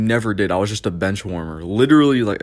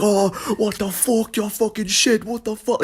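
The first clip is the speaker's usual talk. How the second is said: much higher mock scream; imitated screaming British coach; 13 semitones higher than usual. British coach cursing out the kid goalie, mock screaming. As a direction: imitate a coach cursing in a high, strained yell. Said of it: "He was screaming".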